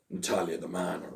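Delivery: low, soft mock imitation of a wrestler; a gruff, deadpan mock version of her line; imitating Natalia. Host mimics a wrestler's backstage interview, low and soft. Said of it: "she was like".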